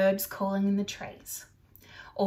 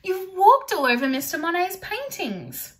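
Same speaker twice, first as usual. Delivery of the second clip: loud, higher telling-off; scolding shout; 9 semitones higher, 7 dB louder than usual. Acted. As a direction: scold the dog for the paintings. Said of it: "shouted Julie".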